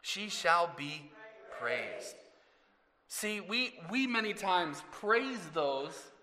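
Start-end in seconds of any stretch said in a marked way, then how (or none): 0.0-2.2 slow and even, the voice dropping at the end; slow repeat. Shown as a demonstration. Preacher leading the congregation through the verse again, slow. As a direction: say it back slowly. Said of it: "Can we say that again?".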